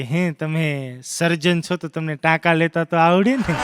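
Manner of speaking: slow, low, soft fiancée voice; soft mock coaxing. Fiancée with broken sandal asks doctor, softly coaxing. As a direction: ease gently into a favour, lightly acted.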